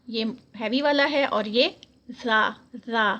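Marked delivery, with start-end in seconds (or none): 2.2-3.1 زا said thin and light, repeated twice. Shown as a demonstration. Teacher shows pupils the thin زال sound. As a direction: keep زا fine and light. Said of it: "زال جو ہے وہ باریک ہے".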